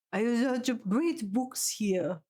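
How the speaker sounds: slow, drawn-out mock French accent. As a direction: mimic a bad French accent, very slowly. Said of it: "Was that supposed to be a French accent?".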